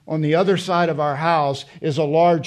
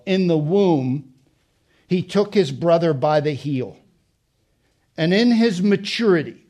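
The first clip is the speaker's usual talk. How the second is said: scripture reading; 3 dB louder than usual. Acted.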